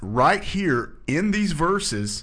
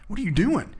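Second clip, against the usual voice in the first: incredulous; 7 semitones higher than usual. Retold or imitated. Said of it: "and he's like".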